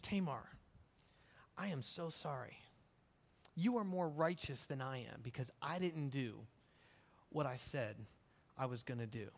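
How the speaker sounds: low, hushed, halting confession; humbled apology. Retold or imitated. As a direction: apologize softly, with long pauses. Judah humbly apologizes to Tamar.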